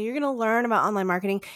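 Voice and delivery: ordinary delivery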